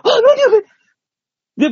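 high squeal of disbelief; high startled what-was-that; shocked. Retold or imitated. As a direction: shriek in sudden shock. Shocked at partner skipping line.

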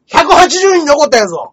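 high, very loud mock-indignant shout; boastful yell. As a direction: yell the punchline of a brag at full force. (自慢げに絶叫)

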